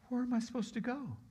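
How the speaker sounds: high, small-voiced helplessness; forlorn question. Healed man refused by Jesus, forlorn question.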